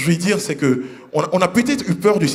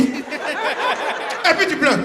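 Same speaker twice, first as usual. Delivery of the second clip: usual speaking voice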